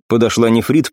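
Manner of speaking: ordinary delivery, nothing marked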